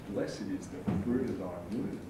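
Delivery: very soft, low murmur; low, faint, transfixed praying; hushed prayer. Acted. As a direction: let the prayer sink almost to nothing. Prayer fixates on one phrase.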